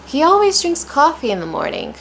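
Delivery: high-pitched, with "always" and "coffee" leaping up; different intonation. Shown as a demonstration. Teacher showing another intonation, high and lively. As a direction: launch high on "always", falling away to "morning". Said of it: "different intonations, different rhythms".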